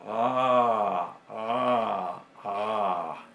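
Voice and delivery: "Ah" con garganta constreñida; ain con garganta. Shown as a demonstration. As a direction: aprieta la garganta al decir "Ah". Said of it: "detrás constringimos la garganta". ("Ah" gutural, constreñida)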